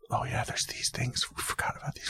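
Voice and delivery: hushed mock whisper; whisper yelling. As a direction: whisper it like a secret. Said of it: "Why are we whispering into a microphone?".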